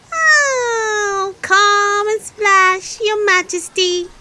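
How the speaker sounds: high, loud, slow call. A narrator's reading. Peppa calling the Queen to splash, high and loud. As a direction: call her over across the puddles. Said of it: "called Peppa".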